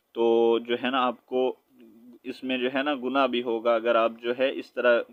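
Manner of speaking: usual speaking voice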